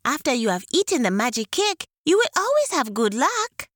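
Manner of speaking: high, coaxing tortoise voice; sly tortoise. Acted. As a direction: tempt them sweetly. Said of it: "said the tortoise".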